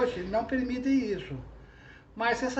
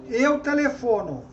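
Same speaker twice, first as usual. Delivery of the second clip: loud, declaiming the first verse; poem recital; 3 semitones higher, 8 dB louder than usual. Shown as a demonstration. Recites her example trova. (recitando a trova em voz alta)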